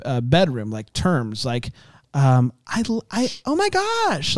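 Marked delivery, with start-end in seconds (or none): 2.7-4.4 put-on happy teen girl. Imitating happy high schooler.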